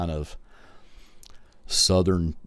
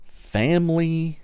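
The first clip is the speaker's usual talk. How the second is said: high, sustained, stretched-out word; drawn-out announcement; 10 semitones higher, 3 dB louder, slower than usual. Real, not acted. Host announcing the episode topic, drawn-out and high. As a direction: hold the word long and high.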